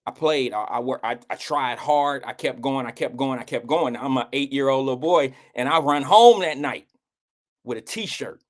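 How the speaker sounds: ordinary talk, nothing marked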